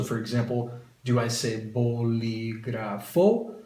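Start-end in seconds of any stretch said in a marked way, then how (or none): 1.7-3.5 bolígrafo stressed on its last syllable, -FO, rising. Shown as a demonstration. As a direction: say bolígrafo as bo-li-gra-FO, drawn out. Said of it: "underline where you pronounce the word".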